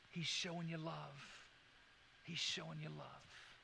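soft, hushed reassurance; tender. Real, not acted. Preacher tells the congregation of love, softly.

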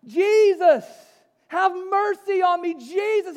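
loud, high desperate plea; crying out. Acted. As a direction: cry out at full voice for mercy. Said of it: "But he cried out".

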